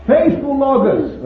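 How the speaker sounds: high, loud cry of revelation. Retold or imitated. Preacher shows what made Paul cry out, loud and high. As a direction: cry it out as if overcome. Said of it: "made this man cry out".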